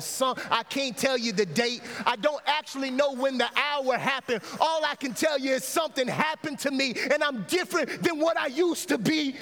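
fervent testimony, pitched high; driving, high-pitched preacher's delivery; impassioned preaching. Real, not acted. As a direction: pour out your testimony to the church. Preaches testimony at full force.

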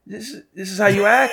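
high-pitched appalled question; incredulous. Retold or imitated. Customer to a rude cigar clerk, incredulous.

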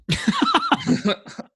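high burst of laughter, far up from his talking voice. Real, not acted. Laughing hard after telling the elevator punchline, a high loud burst of laughter. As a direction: let a big laugh break out.

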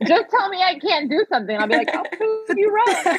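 high-pitched words breaking into real laughter; laughing defiance. Real, not acted. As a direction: laugh your way through the boast. Laughs while quoting own defiant reply.

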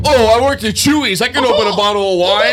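cocky boast. Real, not acted. Bragging about wine skills.

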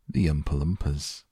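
plain reading voice

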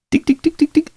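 short sharp high ticks, very high; staccato. Shown as a demonstration. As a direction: tap out tick tick tick high and clipped. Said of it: "it's like a staccato reverse is what it sounds like".

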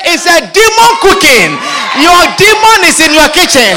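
loud, high declaration; fiery punchline; preaching shout. Real, not acted. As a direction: proclaim the demon in the cooking.

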